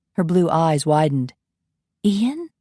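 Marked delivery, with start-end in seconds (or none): 2.0-2.5 higher startled question; startled recognition; surprised belle. Acted. Coralie startled at hearing Ian's name.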